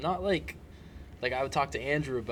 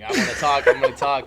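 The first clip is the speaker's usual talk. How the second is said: louder mock-stubborn declaration; playful insistence; 2 semitones higher, 12 dB louder than usual. Guest acting out refusing to shut up, playful loud insistence. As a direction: insist loudly, half in jest. Acts out own insistence on talking.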